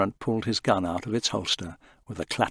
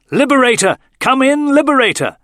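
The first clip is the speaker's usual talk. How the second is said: much higher, loud call into the radio; 11 dB louder than usual. A narrator's reading. Tarrant calling the silent Liberator, loud. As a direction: shout to raise the ship.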